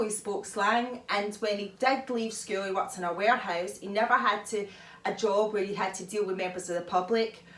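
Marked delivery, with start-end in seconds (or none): none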